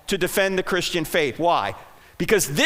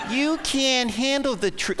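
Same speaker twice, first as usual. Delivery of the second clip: drawn-out, half-hearted movie line; mock a crowd's weak attempt, slow and raised in pitch; feeble wrong delivery; 4 semitones higher, 3 dB quieter than usual. Shown as a demonstration. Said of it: "That's not how he said it".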